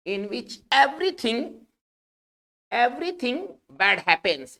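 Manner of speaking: very slow, word by word, for taking notes; slow dictation. Shown as a demonstration. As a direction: spell it out slowly like dictating to a class. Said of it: "इसको तोड़ के कैसे लिखेंगे?". (बहुत धीरे, शब्द-दर-शब्द बोलना)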